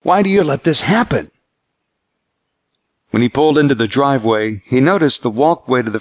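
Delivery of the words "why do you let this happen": high-pitched, demanding plea; a touch of anguished protest. A narrator's reading. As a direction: demand an answer from God, pitch raised. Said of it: "he demanded as he hung up".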